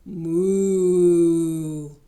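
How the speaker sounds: long, low, loud moo; low like a big cow. Pretend. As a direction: librarian mooing long for the kids. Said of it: "and he says".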